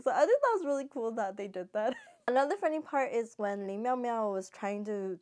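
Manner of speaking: usual speaking voice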